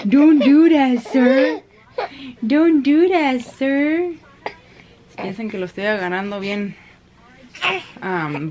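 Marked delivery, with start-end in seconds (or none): 0.1-1.6 louder, higher, laughing; laughing mock scold. Real, not acted. Mother playfully scolding her baby, laughing. 2.4-4.1 higher, louder teasing; playful mock scold. Real, not acted. Mother playfully scolding her baby again.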